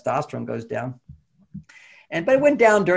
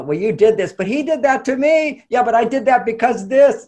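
raised, squabbling voice of a quarreling couple; mock bickering; 8 semitones higher, 6 dB louder than usual. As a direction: bicker like children, tit for tat.

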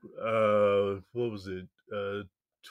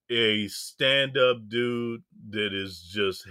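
normal talking voice

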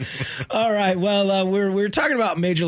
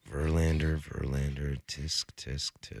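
disappointed tsk-tsk; 12 semitones lower, 10 dB quieter, slower than usual. Real, not acted.

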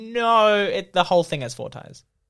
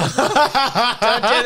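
slow, laughing plea; 4 semitones higher, much slower than usual. Retold or imitated. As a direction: drag it out through a laugh.

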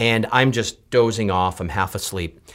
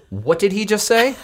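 a touch of a puzzled woman, higher; mild confused seatmate; 8 semitones higher than usual. Retold or imitated. Seatmate asks what the pilot said, lightly voiced higher. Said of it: "the woman next to me says".

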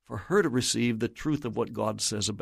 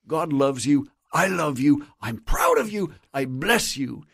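louder, heartfelt fatherly declaration; emphatic blessing; 2 semitones higher, 4 dB louder than usual. Acted. Father blessing his son, emphatic and heartfelt. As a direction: proclaim each blessing firmly to a son.

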